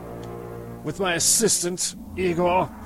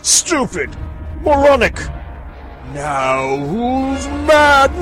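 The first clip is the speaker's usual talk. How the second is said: loud, higher, slow ranting; triumphant rant; 8 semitones higher, 4 dB louder, much slower than usual. Acted. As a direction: rant like a vindicated madman. Mad scientist ranting.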